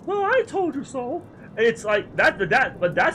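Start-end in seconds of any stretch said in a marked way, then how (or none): 0.1-1.2 much higher, smug little voice; high smug character voice; Papa Limo impression. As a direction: squeak it out smugly. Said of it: "Papa Limo is like".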